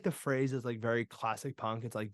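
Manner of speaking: ordinary talk, nothing marked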